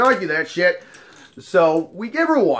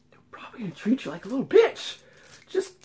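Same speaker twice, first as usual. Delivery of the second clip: sly whispered aside; 6 semitones higher, 7 dB quieter, faster than usual. Real, not acted. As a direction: drop to a whisper for the jab. Pointed aside to listeners.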